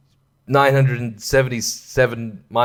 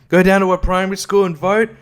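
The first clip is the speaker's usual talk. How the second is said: whining complainer, pitched higher; grumbling local; 7 semitones higher than usual. Retold or imitated. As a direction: gripe about having to vote, voice up high. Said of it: "angry about it".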